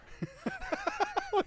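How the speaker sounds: high burst of laughter; laughing. Real, not acted. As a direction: crack up at your own impression. Cracks up at his own impression.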